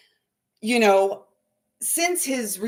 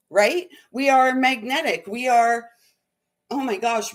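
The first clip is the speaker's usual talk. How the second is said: ordinary delivery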